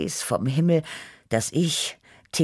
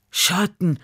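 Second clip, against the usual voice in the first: higher, suffering moan for relief; parched groan; 5 semitones higher than usual. A narrator's reading. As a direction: moan it, worn out by heat. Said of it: "stöhnte ich nach einer Stunde".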